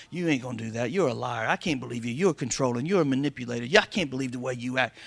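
low, soft, sneering accuser; accuser's voice. Retold or imitated. Pastor voicing the devil accusing a believer, low and soft.

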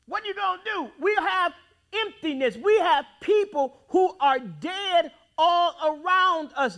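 ordinary delivery

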